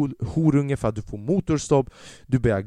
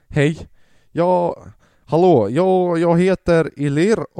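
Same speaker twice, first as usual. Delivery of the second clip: louder, slow, stumbling hello; halting loud phone greeting; 5 semitones higher, 8 dB louder, much slower than usual. Retold or imitated. Father calls bully's parents, slow stumbling loud greeting. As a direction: shout the intro down a bad line.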